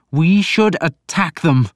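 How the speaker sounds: high, loud, slow firm declaration; hard resolve. A narrator's reading. Alfred towering over the priest, hard and firm. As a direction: stand tall and declare it. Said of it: "a sudden hardness in his voice".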